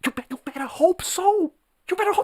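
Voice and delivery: mock taunt. Taunting enemies.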